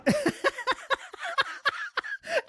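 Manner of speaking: helpless, high-pitched laughter; high laughing fit. Real, not acted. Host cracking up at the streamer's excuse, high laughing fit. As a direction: burst out in uncontrolled high laughs.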